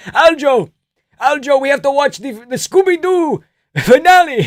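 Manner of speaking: put-on high loud accented insistence; eager high Georgian voice; Merab impression. Merab voiced random advice.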